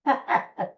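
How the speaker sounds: short high burst of laughter; amused little laugh. Real, not acted. Host watches his dog dash in for snacks, laughing.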